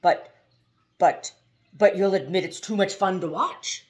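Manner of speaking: loud, stammering giant of a man; booming driver. A narrator's reading. The giant driver teases Haroun about the bus game. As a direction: let the buts burst out jolly and loud.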